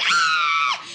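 piercing high mock scream; children's scream. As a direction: shriek like a terrified child. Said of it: "They were like".

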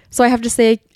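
usual speaking voice

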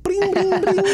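playful high ringing noise; high bell-like bring sounds. Retold or imitated. Imitating hitting a speed bag.

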